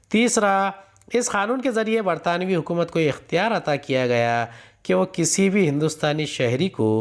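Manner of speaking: ordinary talk, nothing marked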